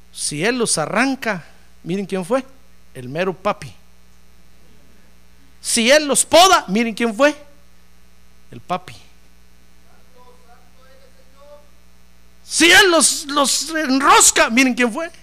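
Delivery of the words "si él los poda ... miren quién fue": louder and higher with each line; climbing loud preaching; rising preaching. Acted. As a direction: build the refrain up. Building rhetorical refrain.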